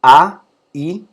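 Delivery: teacher showing the vowels of ai; vowel demo. Shown as a demonstration. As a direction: sound out A then I separately. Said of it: "esta es la secuencia ai".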